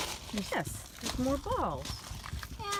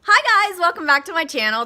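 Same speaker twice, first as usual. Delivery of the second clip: excited greeting; 6 semitones higher, 10 dB louder than usual. Real, not acted. Vlog intro greeting.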